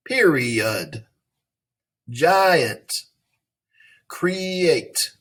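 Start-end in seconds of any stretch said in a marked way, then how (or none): none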